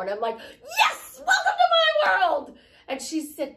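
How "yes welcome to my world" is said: high, loud, drawn-out whoop of agreement; jubilant shout. Retold or imitated. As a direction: cry it out in exaggerated triumph. Acting out her jubilant reply.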